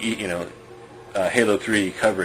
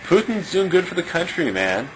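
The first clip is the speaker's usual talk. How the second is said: higher, louder dismissive mock voice; mocking a careless guy; 8 semitones higher, 3 dB louder than usual. Guy shrugging off the war, mock high dismissive voice. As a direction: play up a shrugging know-it-all. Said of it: "And the guy said".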